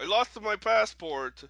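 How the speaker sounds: loud, slow mock Russian accent; half-hearted Russian accent try. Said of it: "I can't do Russian accents".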